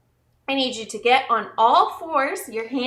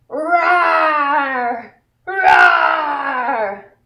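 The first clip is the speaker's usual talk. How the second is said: put-on a playful roar for children; tiger roar; 5 dB louder than usual. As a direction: growl it out like a friendly tiger. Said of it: "pretend to be a tiger".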